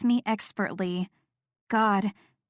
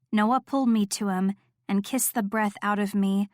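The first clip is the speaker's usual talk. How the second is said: ordinary delivery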